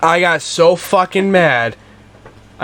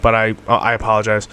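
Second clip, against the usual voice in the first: sincere apology; 6 semitones lower than usual. Real, not acted. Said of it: "I really mean this".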